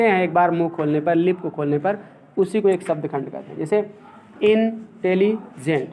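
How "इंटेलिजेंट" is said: इंटेलिजेंट slowly, syllable by syllable, in-te-li-gent; syllables spelled out. Shown as a demonstration. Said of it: "तीन शब्द खंड हो गया".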